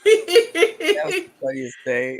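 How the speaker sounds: giggling while saying it; laughing through words. Real, not acted. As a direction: laugh as you say it. Laughing retelling.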